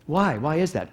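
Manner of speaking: ordinary talk, nothing marked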